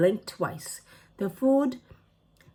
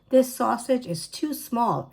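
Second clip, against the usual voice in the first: moaning; 5 semitones higher than usual. A narrator's reading. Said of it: "Ellie moaned".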